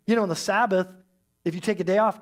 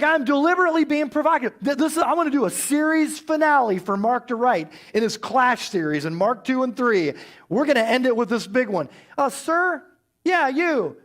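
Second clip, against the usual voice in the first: higher, louder, fast and eager; a bold, eager call-out; playful Jesus voice; 6 semitones higher, 4 dB louder than usual. Pretend. Preacher voices Jesus calling the man forward, eager and loud. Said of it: "He's like".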